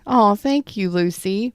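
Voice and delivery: ordinary talk, nothing marked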